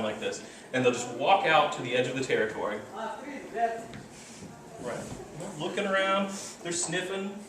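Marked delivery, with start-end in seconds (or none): 3.0-7.4 hushed. Shown as a demonstration. Acting out the silent patrol.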